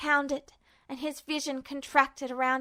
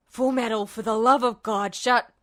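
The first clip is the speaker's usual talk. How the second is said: lower, tense villain; Justin's warning; 3 semitones lower, 3 dB louder than usual. Acted. Justin warns Ed to shut up, tense. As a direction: grit it out in warning.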